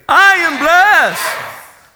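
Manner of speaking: very drawn-out, high, full-force shout; huge, slow declaration, each word stretched; say it like you mean it. Shown as a demonstration. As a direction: belt it out with total conviction. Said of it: "say it like you mean it".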